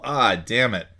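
normal talking voice

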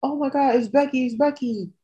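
high, thrilled gasp at the name; excited fan. Retold or imitated. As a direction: squeal it like a fan seeing Becky arrive. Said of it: "You're like".

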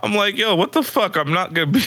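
high-pitched, mildly mock-outraged objection; light mock indignation; incredulous protest. Retells his protest to girlfriend.

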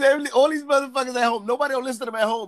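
ordinary delivery

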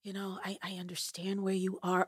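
quiet, tender comfort; soft and comforting. Retold or imitated. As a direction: console her gently. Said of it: "she was like".

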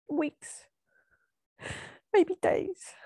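grieving, hushed. Retold or imitated. Daughter takes mum's hand to tell her, hushed and sad. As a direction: break it softly, barely able to say it. Breaking news to dying mum.

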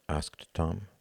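plain reading voice

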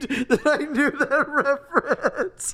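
high, slow, laughing all through; laughing confession. Real, not acted. Laughs at own embarrassing knowledge.

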